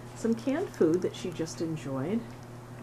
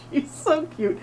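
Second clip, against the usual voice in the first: laughing; 10 semitones higher, 4 dB louder than usual. Real, not acted. Laughing at cute cat.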